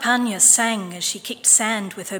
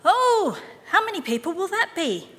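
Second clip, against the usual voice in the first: startled mother; 10 semitones higher, 4 dB louder than usual. A narrator's reading. Mother voiced, worried.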